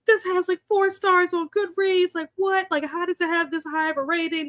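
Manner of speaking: high-pitched retold bewilderment; baffled complaint. Streamer imitating her friend baffled by a book's rating, baffled complaint. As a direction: imitate a friend's incredulous protest, pitched well up. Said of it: "he didn't understand what was going on".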